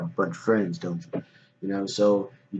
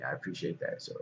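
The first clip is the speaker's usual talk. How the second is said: whispered thanks; 8 semitones lower, 13 dB quieter than usual. Real, not acted. Thanking a viewer quietly.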